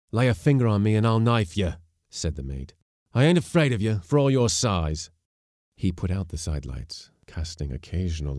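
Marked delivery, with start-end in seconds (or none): 0.1-1.7 fast, louder, higher retort; angry threat. A narrator's reading. Mate threatening the bullying skipper, angry. 3.1-5.1 raised, higher-pitched defiant retort; angry defiance. A narrator's reading. Mate defying the huge skipper, angrily.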